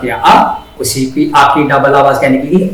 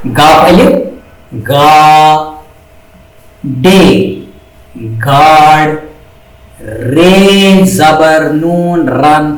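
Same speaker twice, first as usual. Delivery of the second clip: Guard and रन spelled out very slowly; slow spelling out; much slower than usual. Shown as a demonstration. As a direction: sound out each letter slowly for pupils. (धीरे धीरे अक्षर जोड़कर पढ़ना)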